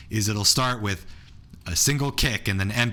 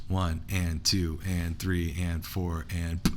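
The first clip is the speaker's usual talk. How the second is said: slow count; 5 semitones lower, 4 dB quieter, slower than usual. Shown as a demonstration. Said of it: "counting slowly in time".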